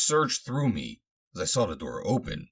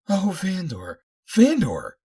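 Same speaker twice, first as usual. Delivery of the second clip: very high, loud cry of relief; high-pitched hysterical outburst; 13 semitones higher, 7 dB louder, slower than usual. A narrator's reading. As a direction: cry the name out in overwhelmed relief. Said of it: "she cried hysterically".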